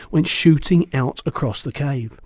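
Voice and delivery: usual speaking voice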